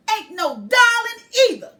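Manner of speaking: put-on high, loud huffy girl; girl protesting. A narrator's reading. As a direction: snap back like an offended kid. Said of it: "she protests".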